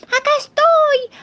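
high crying yell, slow; shouting for help. Acted. The little piglet yelling from the roof. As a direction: cry out from the roof.

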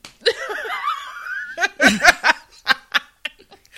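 bursting into high loud laughter. Real, not acted. Friend laughing hard at the Olaf joke, loud burst of laughter.

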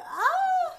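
very high, loud mock wail; hammed-up cry of dismay; fake-cry wail. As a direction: let out a dramatic pretend wail. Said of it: "I was just dramatically fake crying".

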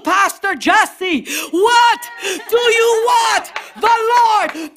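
high, shouted question; preached challenge. Acted. Preacher challenging church members by name, shouted question.

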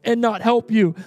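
high, emphatic preacher reading aloud; forceful scripture reading. Acted. Preacher reading Jesus's judgment to the congregation, forceful proclamation.

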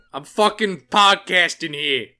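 put-on indignant New Yorker, punching the words; New York accent. Comic telling off New York critics, loud New York accent. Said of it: "my New York accent was bad".

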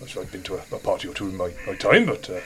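a hint of a baffled warrior; confused barbarian. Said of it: "scratch his head and go".

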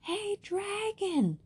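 very high, loud, slow call; loud drawn-out shout into a sleeping dragon's ear; shouting as loud as she can. A narrator's reading. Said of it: "She shouted as loud as she could".

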